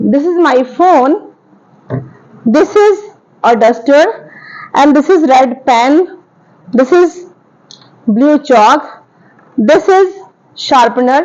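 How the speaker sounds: very slow, pausing between words; slow teaching examples. Shown as a demonstration. Teacher naming nearby objects for students, very slow. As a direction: lay out each example for the class. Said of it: "मुझे बताना है this और that के बारे में".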